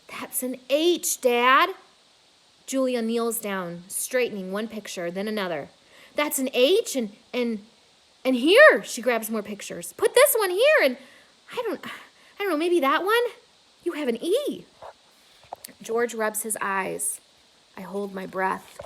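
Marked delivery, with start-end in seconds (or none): 0.1-1.7 excited discovery. Acted. 6.1-14.6 high, loud, rushing excitement; excited. Acted. Julia frantically arranging pictures.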